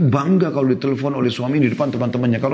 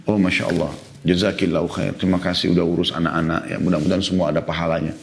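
lower, gentle thanks to his wife; grateful praise; 6 semitones lower than usual. Shown as a demonstration. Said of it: "Anda bilang begini". (ucapan terima kasih yang lembut dan tulus)

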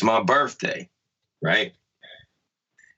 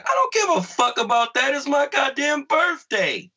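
mock indignant outburst; 11 semitones higher than usual. Retelling how he snapped at his girlfriend: high, mock-furious outburst. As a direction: replay the outrage, pitched way up and dragging the last word.

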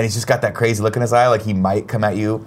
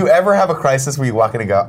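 usual speaking voice

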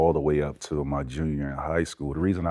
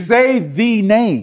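much higher and louder, slow and drawn out; insistent demand; 9 dB louder, much slower than usual. Real, not acted. Pushing the host to say Vince Carter, slow insistent demand. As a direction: drag each word out to force the answer. Pressing host to name dunker.

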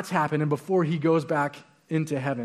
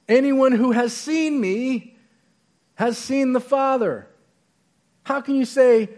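forceful scripture reading; 4 semitones higher, 4 dB louder than usual. Acted.

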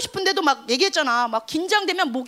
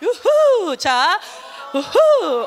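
whooping cheer; 2 semitones higher, 5 dB louder, much slower than usual. Real, not acted. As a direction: cheer like at a party. Showing the crown.

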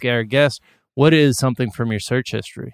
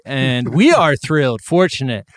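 rising, louder hype intro; hype announcer; 5 semitones higher, 5 dB louder than usual. Acted. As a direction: build up like a ring announcer. Hyped guest introduction.

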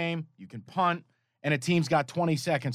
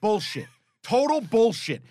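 louder, higher, slow outraged protest; 6 semitones higher, 5 dB louder than usual. Real, not acted. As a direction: spit it out, disgusted. Ranting about a bad beat.

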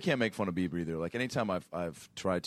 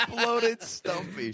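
high laughing repeat; 14 semitones higher, much slower than usual. Real, not acted. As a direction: squeak the words through a laugh. Repeating the joke while laughing.

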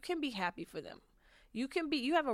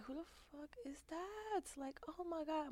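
higher, hushed, struck by a cute guy; awed surprise; 8 semitones higher, 10 dB quieter than usual. Retold or imitated. Seeing the guy she liked, hushed amazed higher voice. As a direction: gasp it out under your breath. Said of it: "I was like".

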